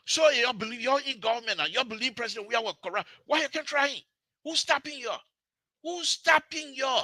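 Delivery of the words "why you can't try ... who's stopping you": high-pitched, insistent questioning; indignant demand, pitched right up; exasperated challenge. Real, not acted. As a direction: throw it at them as a high, pressing challenge. Challenging opponents to prosecute.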